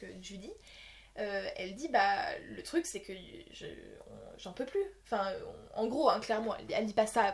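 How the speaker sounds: ordinary delivery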